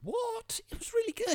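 very high, incredulous; surprised. Real, not acted. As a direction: squeak out the disbelief. Disbelief at friend's opinion.

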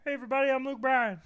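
[name]. Retold or imitated. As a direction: tease with a quick star hello. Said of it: "my girlfriend has just looked at me and went".

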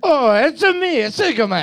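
hammed-up Italian accent, high and loud; a cartoon Italian "it's a me" voice. Friend asked for an Italian accent, so he hams it up. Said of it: "Can we just do an Italian accent instead?".

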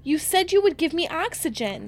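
high, loud panicked pleading; Candace screaming. Retold or imitated. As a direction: beg in panic as if you're suffocating. Retold child pleads.

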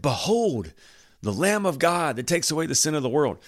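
proclaiming John. Acted. Preacher as John pointing at Jesus in the crowd, proclaiming. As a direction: point him out to the whole crowd. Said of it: "he's like".

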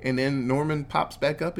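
plain reading voice

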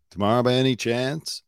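"chance" glides up at the end; imitating rising question. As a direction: mimic the caller's lift on "chance?". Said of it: "it's the way his voice goes up".

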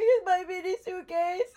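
high, loud, slow sobbing wail; crying wail. Retold or imitated. To a pilot after losing her bag, a loud crying wail.

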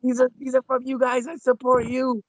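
high-pitched pandering voice; squeaky fake sincere voice; high mock voice. Imitating Chris pandering.

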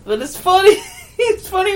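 giggling through the words; can't stop laughing while talking; giggly, high and amused. Real, not acted. Giggling about foreign languages.

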